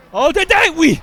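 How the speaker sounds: loud high whoop of joy; excited cheer. Real, not acted. Gamer cheering Dédé's play on screen, loud excited whoop. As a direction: cheer the teammate on full voice.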